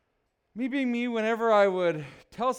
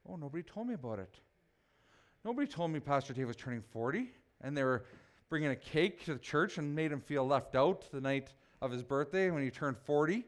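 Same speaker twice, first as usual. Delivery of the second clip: mock hurt; 5 semitones lower, 5 dB quieter than usual. Mocking a hurt left-out churchgoer.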